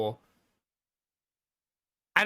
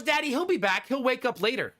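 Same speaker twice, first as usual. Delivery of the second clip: put-on higher, bright child's voice, sure of himself; hopeful little son; 8 semitones higher than usual. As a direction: sound like a kid certain the lion returns. Son rationalizing Mufasa's death.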